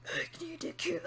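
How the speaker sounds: whispered menacing line; mock villain whisper. Imitating Cicada's line from the show, in a whisper. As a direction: breathe it out like a brooding villain. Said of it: "he's just like".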